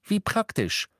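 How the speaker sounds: high, heated comeback; testy, aggrieved bickering; quarrelling retort. A narrator's reading. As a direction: argue back irritably, pitched high. Friends quarrel, retort about injured arm.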